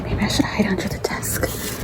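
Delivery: hushed whisper while hiding. Real, not acted. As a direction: whisper so nobody hears. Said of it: "hiding, whispering to camera".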